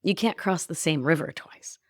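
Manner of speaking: ordinary talk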